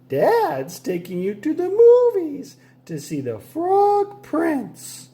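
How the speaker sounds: high, slow mother's voice; mom voice. A narrator's reading. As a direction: announce the plan cheerfully as Mom.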